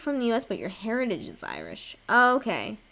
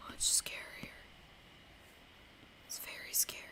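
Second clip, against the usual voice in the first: hushed, breathy whisper; spooky little whisper; 15 dB quieter than usual. Real, not acted. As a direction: whisper it like it's scary. Whispering about a scary game.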